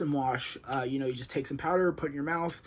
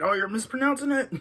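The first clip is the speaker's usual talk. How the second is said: high, louder mock complaint; imagined viewer's objection; 11 semitones higher, 7 dB louder than usual. Voicing an annoyed viewer correcting him, mock objection in a raised voice. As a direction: pipe up as a nitpicking viewer, pitched well up. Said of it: "is, is thinking".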